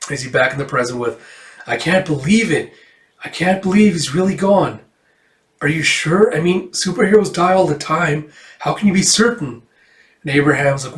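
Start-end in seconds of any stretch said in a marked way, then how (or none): 1.7-9.6 higher, lightly voiced young woman; a touch of pleading disbelief; disbelieving daughter. Daughter questions news of father's death, disbelieving.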